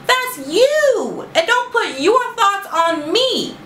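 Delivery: high, indignant comeback with a laugh; sassy retort. Retold or imitated. Mocking the pork question, high and indignant. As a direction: pitch it way up, incredulous and sassy. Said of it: "someone had asked me".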